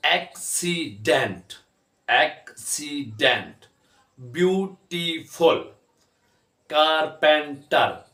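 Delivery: एक्सीडेंट, ब्यूटीफुल, कारपेंटर slowly cut into three beats each; slow three-part एक्सीडेंट, ब्यूटीफुल, कारपेंटर; syllable by syllable. Shown as a demonstration. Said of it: "तीन हिस्सों में इसको तकसीम किया".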